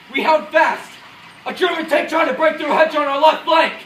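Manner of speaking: loud, high, forceful war narration. Acted. Officer recalling the stand on the front line, loudly. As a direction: tell it as if still under fire.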